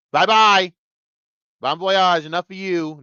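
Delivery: usual speaking voice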